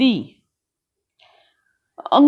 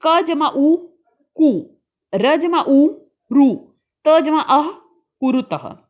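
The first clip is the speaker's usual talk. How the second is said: slow spelling, each sound joined aloud; teacher slowly joining letters into कुरुतः; slow letter-by-letter building; 4 semitones higher than usual. Shown as a demonstration. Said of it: "हमें एक साथ करके लिखना है".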